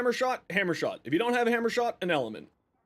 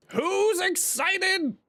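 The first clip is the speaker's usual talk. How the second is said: much higher, slow and stretched; big hype question; 13 semitones higher, much slower than usual. Acted. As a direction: drag it out like a hype host.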